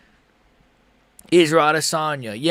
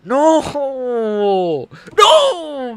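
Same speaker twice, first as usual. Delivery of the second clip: anguished no; 9 semitones higher than usual. Real, not acted. Reaction to sad loser's face.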